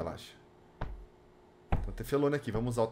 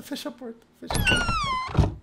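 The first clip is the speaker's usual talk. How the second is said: scared horror game streamer. Real, not acted. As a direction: beg them to shut the door. Panicked pleading in a horror game.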